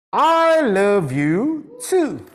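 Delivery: slow, drawn-out loving reply; tender reply. Shown as a demonstration. Teacher voicing the girl answering her boyfriend, slow and tender. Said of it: "So her reply".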